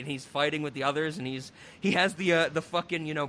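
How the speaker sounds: ordinary talk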